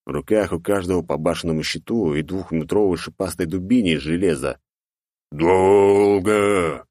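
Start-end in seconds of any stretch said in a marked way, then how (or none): 5.3-6.9 bellowing ogre roar. Acted. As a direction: roar it out loud. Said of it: "взревел второй в шлеме". (рёв огра)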